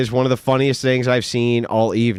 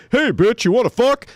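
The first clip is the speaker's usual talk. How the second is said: higher, brash crude come-on; crude pickup line; 10 semitones higher than usual. Shown as a demonstration. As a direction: blurt it out like a clueless creep. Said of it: "You can't do that".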